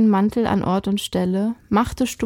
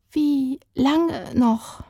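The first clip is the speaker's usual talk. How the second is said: slow, higher, trembling; 5 semitones higher, much slower than usual. Acted. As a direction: ask it with a shaking voice. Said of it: "fragte sie mit zitternder Stimme".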